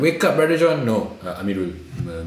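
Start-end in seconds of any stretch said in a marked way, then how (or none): 0.0-0.8 sergeant's call. Retold or imitated. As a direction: mimic a drill sergeant yelling at recruits. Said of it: "Malay sergeant".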